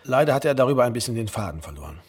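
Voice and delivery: usual speaking voice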